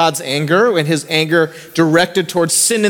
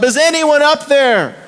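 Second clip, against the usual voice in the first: retold yell for help; 9 semitones higher than usual. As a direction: call out from the cliff for help. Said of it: "he yells for help".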